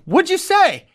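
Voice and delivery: high-pitched, eager disbelief; astonished question. Retold or imitated. Coach to the boy who confronted another coach, astonished. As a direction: act out a stunned, excited question. Coach reacting to boy's news.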